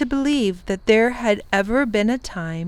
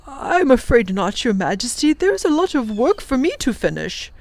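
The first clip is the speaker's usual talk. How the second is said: lightly voiced kindly old professor, apologetic; polite professor; 5 semitones higher, 3 dB louder than usual. The professor declines the Wangdoodle's request, apologetic. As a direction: decline gently but firmly.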